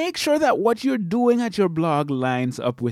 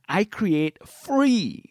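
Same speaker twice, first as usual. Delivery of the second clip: "free" stretched slow and pitched up; stress on free; 4 semitones higher, much slower than usual. Shown as a demonstration. Blogger stressing that her videos are "free", drawn out slow. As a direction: lean hard on "free", draw it out. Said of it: "I wanna put emphasis on the word free".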